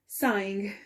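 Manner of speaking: usual speaking voice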